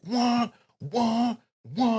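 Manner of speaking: high imitated alarm-like "wah"; map drop noise. Podcaster showing co-host how his red map drops sound, high mimicked noise. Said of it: "you just hear this noise".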